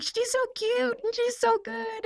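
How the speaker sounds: high, cooing fan praise; adoring gush. Acted. Actress admiring herself on screen like another person, adoring gush. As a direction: gush over her in a high voice.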